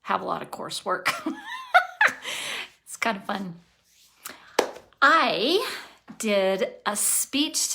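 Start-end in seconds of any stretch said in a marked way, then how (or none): 1.1-2.7 a short high laugh. Real, not acted. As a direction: laugh it off, amused at herself. Laughs about coursework.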